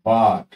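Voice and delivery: "বাট" with a long aa; drawn-out a. Shown as a demonstration. Teacher giving a long-vowel example, drawn-out aa in "বাট". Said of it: "আমরা টেনে উচ্চারণ করি".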